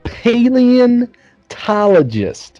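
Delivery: slow correction. Real, not acted. Correcting a friend's word.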